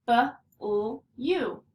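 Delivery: B-L-U as three separated sounds; sounding out. Shown as a demonstration. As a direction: break blue into "B", "L", long "U". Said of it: "U is saying its name".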